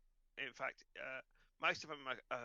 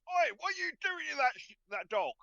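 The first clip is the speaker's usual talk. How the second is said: high, loud challenging shout; indignant "oi" holler; angry stable hand; 13 semitones higher, 11 dB louder than usual. Acted. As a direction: yell across the yard at someone meddling. Stable guy yells at them.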